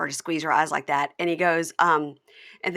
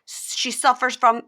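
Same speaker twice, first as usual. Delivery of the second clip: imitating Jasmine; 6 semitones higher, 7 dB louder, slower than usual. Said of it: "And she goes".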